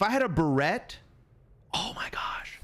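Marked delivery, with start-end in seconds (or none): 1.7-2.6 soft, voiceless "oh my gosh"; awed whisper. Real, not acted. Streamer imagines having a barrette, awed whisper. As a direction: whisper it in awe.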